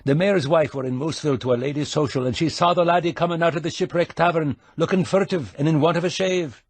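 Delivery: Scottish brogue. A narrator's reading. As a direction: needle him in a quick Scottish brogue. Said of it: "putting on the brogue".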